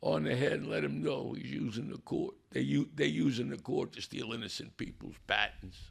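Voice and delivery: high, hushed urgent begging; retold begging plea; begging prayer. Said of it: "I'm begging".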